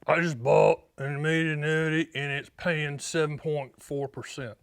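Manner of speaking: a lightly put-on caller, slowing down on the rate; client voice. Caller boasting to Stan about his seven point four percent, in a mild put-on client voice. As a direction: play the caller proudly quoting his annuity rate. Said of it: "I do my client voice".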